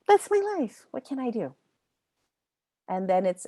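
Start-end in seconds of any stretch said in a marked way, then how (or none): 0.1-1.5 mock defeated whine. Teacher parodying a hurting student's excuse, hammed-up resigned cry. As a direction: wail it like a shrug in words. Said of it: "kind of defeated and sad".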